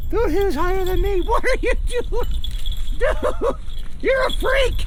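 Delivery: high, giggly amazement; laughing disbelief. Real, not acted. To the camera about his dog, laughing amazement. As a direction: talk through the laughter.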